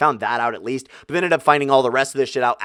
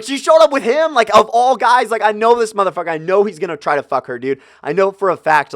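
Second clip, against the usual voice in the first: higher and louder, ranting in a fury; angry rant; 7 semitones higher, 4 dB louder than usual. Retold or imitated. Ranting to Ron in the car about the girl, angry. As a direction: vent it all out at your buddy. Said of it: "venting and ranting and screaming and cussing".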